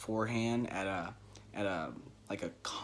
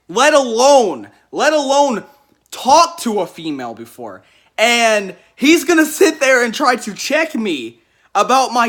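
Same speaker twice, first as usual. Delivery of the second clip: loud, much higher outraged rant; loud, indignant disbelief; 10 semitones higher, 13 dB louder than usual. Retold or imitated. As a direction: vent the outrage at full volume. Indignant rant about the man.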